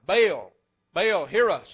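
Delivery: loud, pleading cry to the idol; desperate calling out. Acted. Prophets of Baal shouting to their silent god, loud pleading. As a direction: shout it up to a god who won't answer.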